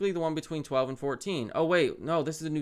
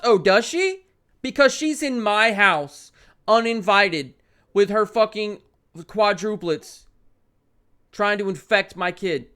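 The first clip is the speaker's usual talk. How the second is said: louder, slowing on the outraged words; indignant rant; 4 semitones higher, 7 dB louder, slower than usual. Real, not acted. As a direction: vent at the intruder in the story. Ranting at neighbour in game.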